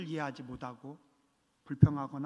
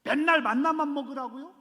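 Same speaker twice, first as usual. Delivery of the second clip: mock whiny complaint; 8 semitones higher, 5 dB louder than usual. Voicing whiny Israelites.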